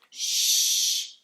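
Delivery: sharp unvoiced hush; hushing shh. Others hushing the centipede, sharp shh. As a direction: hiss a warning for silence. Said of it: "the others whispered".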